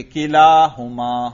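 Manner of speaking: کلاہما with the alif after lam held long. Shown as a demonstration. Teacher showing the raf' form read with alif, drawn out. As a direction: draw out the laa in کلاہما, slow and chanted. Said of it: "الف کے ساتھ پڑھیں گے".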